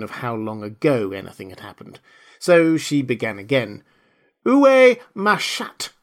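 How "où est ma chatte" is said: high, slow, loud French question; Alice trying French. A narrator's reading. Alice tries her lesson-book French on the mouse, slow and high.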